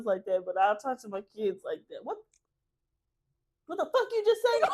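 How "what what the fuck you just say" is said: very high-pitched incredulous scolding; high mock-outraged squeal at a child; mock parental outrage. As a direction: play a parent shocked by backtalk, pitched way up. Mother acts scolding her kids.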